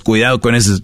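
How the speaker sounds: ordinary delivery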